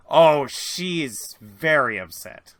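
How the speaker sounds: ordinary talk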